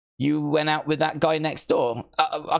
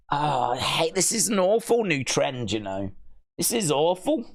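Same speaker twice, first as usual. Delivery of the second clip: ordinary talk, nothing marked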